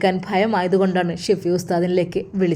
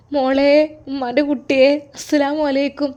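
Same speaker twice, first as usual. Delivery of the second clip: emotional mother; 6 semitones higher than usual. Acted. Mother greets her daughter on the phone, overcome. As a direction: call out to a long-missed child.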